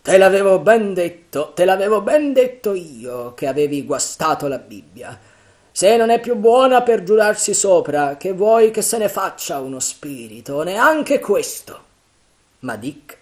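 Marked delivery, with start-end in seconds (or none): 0.0-11.9 loud, high jeering; pirate captain teasing a scared sailor; mocking Silver. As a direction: taunt Dick about his Bible. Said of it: "Silver lo canzonava".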